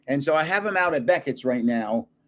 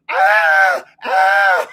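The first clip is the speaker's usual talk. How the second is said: loud breathy yelps of pain; hammed-up pained shout; 2 semitones higher, 8 dB louder than usual. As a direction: cry out like the man just circumcised. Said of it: "And he's like".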